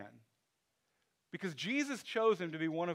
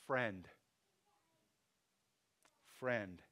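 politely cool; 7 semitones lower, 4 dB quieter than usual. Retold or imitated. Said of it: "this politely cool, generic form of address".

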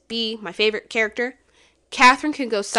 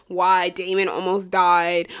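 ordinary talk

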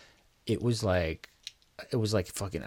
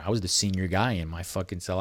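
ordinary talk, nothing marked

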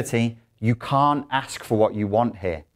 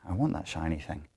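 covetous murmur; 5 semitones lower, 11 dB quieter than usual. Acted. Voicing a psychopath's inner thought.

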